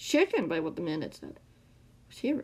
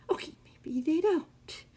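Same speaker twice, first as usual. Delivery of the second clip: slow, high-pitched whine; whining; 8 semitones higher, slower than usual. A narrator's reading. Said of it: "the man whined".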